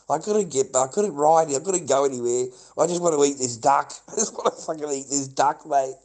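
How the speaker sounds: loud, repetitive gushing breaking into a laugh; carried-away raving. Real, not acted. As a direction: rave helplessly about food you adore. Raves about irresistible street duck.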